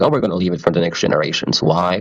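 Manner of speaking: ordinary talk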